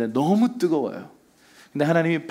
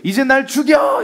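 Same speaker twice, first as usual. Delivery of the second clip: high, loud, drawn-out mock yell; hammed-up furious shout; angry outburst; 9 semitones higher, 6 dB louder than usual. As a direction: act out an exasperated cry to God. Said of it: "화를 내면서 뭐라고 얘기해요".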